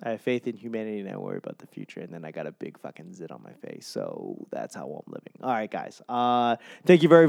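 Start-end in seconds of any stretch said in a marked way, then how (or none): 1.0-5.3 mumbled aside. Real, not acted. Gloomy self-deprecating aside.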